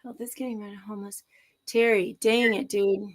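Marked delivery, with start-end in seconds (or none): 0.0-1.2 muttered unvoiced aside; whispered read. Real, not acted. Host reading a chat comment, whispered. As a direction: read it under the breath.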